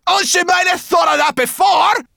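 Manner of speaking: high, loud grumbling yell; indignant shout back. A narrator's reading. Sorrel answering Ben about the retreating bird, indignant shout. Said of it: "Sorrel shouted back".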